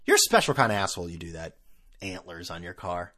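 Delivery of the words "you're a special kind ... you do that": usual speaking voice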